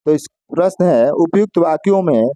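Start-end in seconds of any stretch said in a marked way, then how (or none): none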